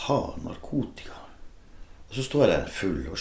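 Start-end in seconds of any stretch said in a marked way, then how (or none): 0.1-1.2 hushed, breathless whisper of a dealer; whispered offer. Retold or imitated. Stranger in a toilet offering drugs, whispered. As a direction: lean in and whisper the question. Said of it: "Så spør han meg".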